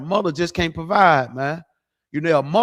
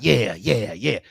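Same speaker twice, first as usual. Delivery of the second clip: lower, breathy-free mock moan of pleasure; 5 semitones lower, slower than usual. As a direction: ham up a fake moan. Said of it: "moaning, talking about".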